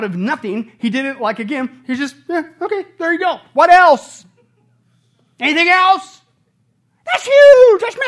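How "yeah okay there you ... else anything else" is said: offhand, breezy God tossing off the stars; a nonchalant creator, bored and higher; casual God. Acted. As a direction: shrug it off like it's nothing.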